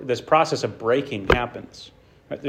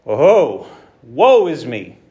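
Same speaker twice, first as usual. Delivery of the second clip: lament; 8 dB louder, much slower than usual. Retold or imitated. Isaiah crying out before God.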